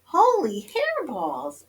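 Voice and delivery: high, slow, drawn-out amazement; astonished exclamation. A narrator's reading. Kitty boy at the mirror, exclaiming in amazement. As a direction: exclaim it in stretched, high-pitched wonder. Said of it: "exclaimed Skippy John Jones".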